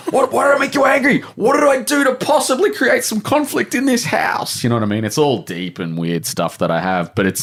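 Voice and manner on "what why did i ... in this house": high, fast hammed-up indignant yell; mock outraged shout. Dad mocking his own hurt reaction to his kids, hammed-up high shout. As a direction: play the wounded parent, squealing in mock outrage.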